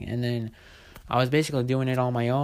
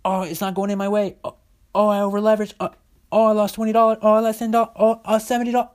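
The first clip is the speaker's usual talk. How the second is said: high, loud whining mockery of a losing trader; mock a panicked trader wailing over every lost dollar; whiny panicking trader; 8 semitones higher, 6 dB louder, faster than usual. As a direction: whimper each loss in a shrill, mocking panic. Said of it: "They're like".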